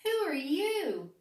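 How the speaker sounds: the cat asking the lost dog. A narrator's reading. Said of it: "said the voice".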